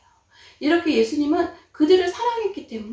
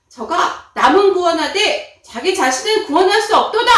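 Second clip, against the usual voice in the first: loud, higher taunting shout; mocking jeer; 6 semitones higher, 12 dB louder than usual. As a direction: jeer it out scornfully. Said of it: "이러면서 예수님은 조롱하기 시작했어요".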